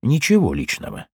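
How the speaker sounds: bitter echo. A narrator's reading. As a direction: repeat it with dark irony. Narrator echoes it bitterly.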